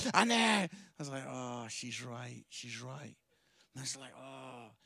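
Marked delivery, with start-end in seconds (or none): 1.3-4.7 low, quiet, deflated mumble; mock crestfallen, sinking voice; grudging admission. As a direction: admit defeat slowly under your breath. Grudgingly admitting his wife is right.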